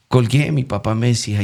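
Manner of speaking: ordinary delivery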